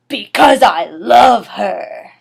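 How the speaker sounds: loud, slow, heartfelt shout; a dramatic outburst of love; passionate declaration. Acted. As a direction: shout the confession slowly.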